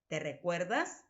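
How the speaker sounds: ordinary delivery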